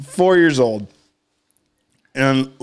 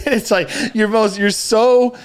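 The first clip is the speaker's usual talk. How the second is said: normal talking voice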